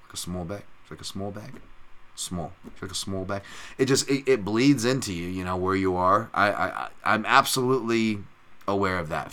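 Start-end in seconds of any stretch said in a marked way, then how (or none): 0.1-3.4 soft, low retold line, "small" oddly voweled; altered "small". Puzzling over their own word "small", quiet odd vowel. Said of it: "why am I saying small".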